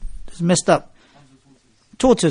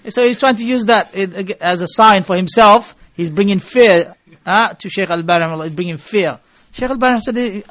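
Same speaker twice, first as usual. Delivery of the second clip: usual speaking voice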